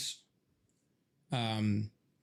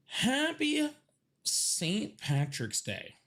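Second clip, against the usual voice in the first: sing-song greeting; 6 semitones higher, much slower than usual. Shown as a demonstration. Greeting his viewers in a silly sing-song holiday voice. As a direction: stretch Happy way up, drag out the rest. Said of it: "holiday greeting, first take".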